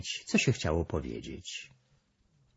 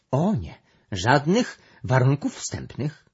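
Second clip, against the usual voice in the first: higher, slower worried objection; 6 semitones higher, 4 dB louder than usual. A narrator's reading. Brąba objects to any preconditions for the discussion, worried. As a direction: protest with concern, voice raised. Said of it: "z zaniepokojeniem powiedziała Brąba".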